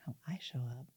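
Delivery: whispered, confused echo; puzzled whisper. Retold or imitated. Athlete echoing coach's words, whispered confusion. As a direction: breathe the question out, baffled.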